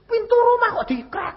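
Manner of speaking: high, incredulous complaint; mock indignation. Mimics the door being forced. (heran dengan nada tinggi)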